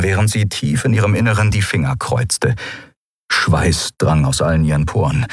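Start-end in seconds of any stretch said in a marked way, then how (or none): none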